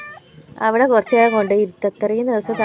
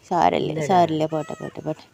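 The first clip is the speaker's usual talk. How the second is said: soothing hush; 6 semitones lower than usual. Real, not acted. Soothing the shivering cat.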